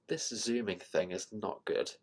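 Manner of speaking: half-voiced private grumble; under-the-breath complaint about the zoom; muttered whisper. Real, not acted. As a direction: whisper it to yourself as an aside. Muttered aside about chart zoom.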